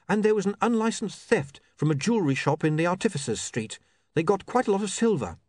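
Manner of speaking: put-on keen young woman reporter. Saccharissa reporting a theft to William, keen. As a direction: share your scoop brightly.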